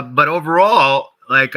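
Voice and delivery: normal talking voice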